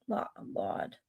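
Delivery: Laud gefluisterd met open a; whispered Laud. Shown as a demonstration. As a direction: fluister Laud als lahd. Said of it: "in the line of saying".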